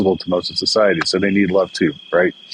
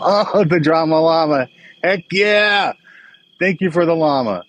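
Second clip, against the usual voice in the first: delighted; 8 semitones higher than usual. Real, not acted. Llama gift arrives, delighted.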